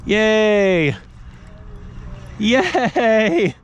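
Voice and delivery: high, gleeful yay; excited cheer. Real, not acted. Celebrating a fish on the line.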